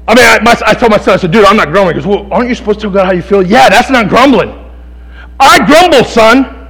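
high, loud pulpit preaching; impassioned preaching. Acted. Preacher hammering Scripture home to the congregation, full-force preaching. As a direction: preach it hard, punching key words.

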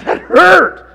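high, loud, laughing; laughing about getting stabbed. Retold or imitated. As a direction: laugh out the complaint. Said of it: "I'm like".

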